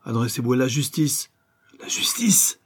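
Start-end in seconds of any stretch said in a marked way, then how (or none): 1.8-2.6 very high-pitched, scoffing echo; sneering disbelief. A narrator's reading. Ex-convict mocks the banker's advice, sneering. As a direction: jeer the word back, pitched right up. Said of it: "Ricana l'ex forçat".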